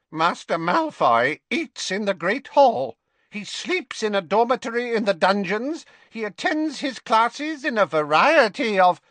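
put-on a sulky old elf reciting a report; old house elf. A narrator's reading. Creature reports grudgingly.

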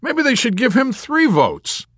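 high, loud, drawn-out suggestion; gushing. Acted. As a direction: propose it with gleeful enthusiasm. Yossarian gushing over chaplain.

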